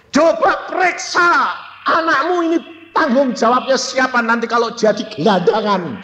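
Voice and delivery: high, forceful rebuke; retold scolding. Child rebuking parents about responsibility, high angry scolding. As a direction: demand answers, pitched high and easing down.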